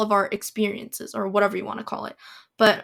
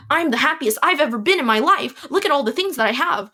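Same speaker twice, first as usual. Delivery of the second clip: high, sing-song mock happiness; exaggerated, higher-pitched boast of being happy; 4 semitones higher, 3 dB louder than usual. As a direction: gush as if overcompensating, in imitation. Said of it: "when she was having her mental breakdown".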